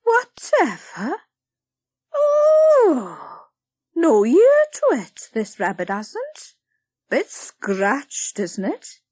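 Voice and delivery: high, loud shocked busybody; Blodwin, appalled. Acted. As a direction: scorn the scratched toys.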